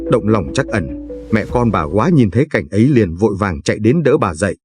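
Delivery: usual speaking voice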